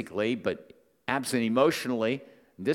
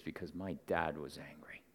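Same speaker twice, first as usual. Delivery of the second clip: hushed, near-whispered admission; retold grim confession; 9 semitones lower, 13 dB quieter than usual. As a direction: let it out very low and quiet, as the smile goes. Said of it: "the smile went away, and she goes".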